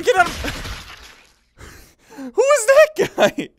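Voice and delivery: cracking up mid-sentence; giggling disbelief; laughing. Real, not acted. Laughing at a flying prince.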